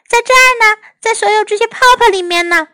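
high-pitched answering call; calling back. A narrator's reading. Amanda answers Mother from the bubbles, high calling voice. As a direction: answer high so you can be found.

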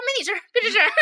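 loud, curt order to stay out; stern brush-off. Retold or imitated. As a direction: shut her friend up firmly. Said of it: "我说". (板着脸大声喝止)

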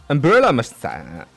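normal talking voice

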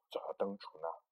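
soft unvoiced whisper. A narrator's reading. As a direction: whisper it secretly. Whispered narration.

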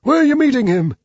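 high, loud owl voice. Acted. Owl asks the mouse about the Gruffalo, high owl voice.

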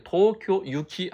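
東京行き with 行き said "yuki"; yuki reading. Shown as a demonstration. Teacher noting the bound-for-Tokyo form, "yuki" in 東京行き. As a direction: start the 行き of 東京行き with "yu". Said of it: "还是要用行き哦".

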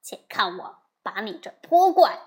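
loud furious rebuke; angry scolding. Acted. Wukong cursing the demon king, loud and angry. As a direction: roar the threat at the demon. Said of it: "悟空骂道".

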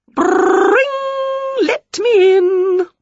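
high ringing door yell, slow demand; talking door bell. Acted. The door yell demands to be let in, high ringing. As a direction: ring out then demand entry.

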